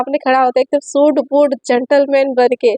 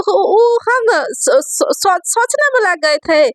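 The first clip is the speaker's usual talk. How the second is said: flustered stammer; 5 semitones higher, slower than usual. Acted. Satya flustered, stammering an excuse.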